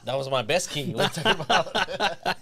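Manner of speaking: mock offended. Mock-offended after being teased.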